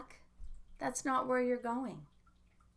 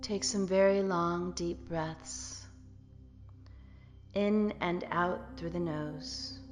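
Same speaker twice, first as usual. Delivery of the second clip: guided meditation. Acted. Leads the closing meditation.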